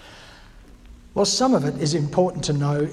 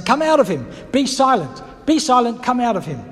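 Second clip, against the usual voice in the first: commanding, loud and higher, rebuking the spirit; stern rebuke; 5 semitones higher, 5 dB louder than usual. A narrator's reading. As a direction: order the demon out with force.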